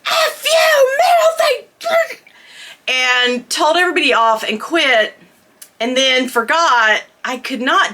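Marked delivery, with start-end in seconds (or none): 0.1-1.6 mock dramatic shout. Teen retelling her dramatic exit to listeners, with a high teary yell. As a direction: ham up the big dramatic exit. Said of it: "this huge dramatic".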